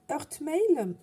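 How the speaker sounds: English words in a French accent. Real, not acted. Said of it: "Désolée pour mon accent".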